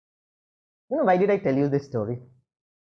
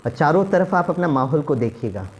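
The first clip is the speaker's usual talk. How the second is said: normal talking voice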